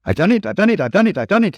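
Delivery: high, proud chant. Acted. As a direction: crow it over and over. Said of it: "he said proudly".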